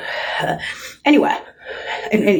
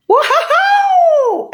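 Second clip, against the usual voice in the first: loud laughing woohoo; gleeful shouted woohoo; whoop; 5 dB louder than usual. Real, not acted. Cheering shout.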